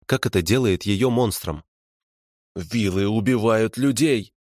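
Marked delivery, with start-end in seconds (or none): 2.6-4.3 slow, seething hiss; hissed accusation. A narrator's reading. Prisoner accuses vilas of killing people, hissing. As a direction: hiss it out slowly. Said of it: "прошипел он".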